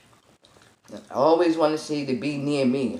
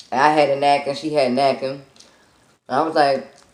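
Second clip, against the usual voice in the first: ordinary talk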